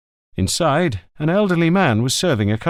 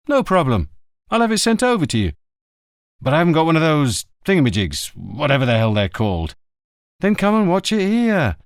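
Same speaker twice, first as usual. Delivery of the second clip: a touch higher, brisk and casual; easygoing friend on the phone, a bit quicker; lightly voiced journalist; 4 semitones higher than usual. A narrator's reading. Journalist Zitto chatting with Montalbano by phone, breezy.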